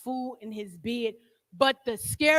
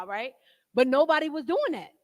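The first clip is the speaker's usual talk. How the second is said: ordinary talk, nothing marked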